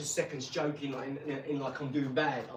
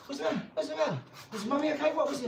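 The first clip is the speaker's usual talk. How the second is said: higher, rushed worried questions; panicked dad; 12 semitones higher, faster than usual. Retold or imitated. Dad rushing upstairs to his wife, panicked high questions. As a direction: fuss over her in alarm. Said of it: "So I'm like".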